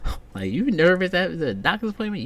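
ordinary talk

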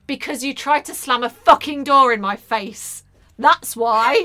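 loud mock-angry outburst; exasperated retort. Mother acts out her unsaid retort to daughter, loud exasperation. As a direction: let the pent-up exasperation out loudly.

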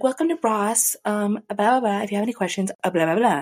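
high, bright-pitched store greeter singsong; mock chirpy retail welcome; perky greeter voice. Greeter voiced in retold story.

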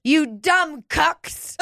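high, very slow mock scolding; mock outrage. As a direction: drag it out in a mocking, high voice. Mock Greta rant continued.

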